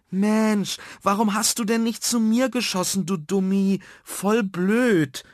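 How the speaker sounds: high-pitched annoyed outburst; cross boy calling out. A narrator's reading. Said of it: "und war richtig sauer auf Nuno".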